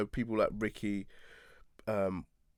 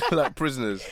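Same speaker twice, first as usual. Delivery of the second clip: laughing through the words; 6 semitones higher, 5 dB louder than usual. Real, not acted. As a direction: chuckle it out. Laughing while describing probation.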